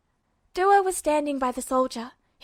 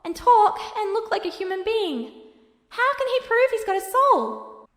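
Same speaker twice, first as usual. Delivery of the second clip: put-on much higher, distressed boyish voice pleading; Alphonse, upset; 6 semitones higher, 3 dB louder than usual. Alphonse argues to Edward that Duo has a soul, high and distressed.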